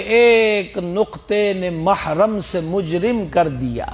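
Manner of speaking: slow recited verse line; poetry recital. Shown as a demonstration. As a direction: draw the misra out like a poet. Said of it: "ایک مصرع ہے شعر کا، وہ کہتے ہیں". (آہستہ شعر پڑھنا)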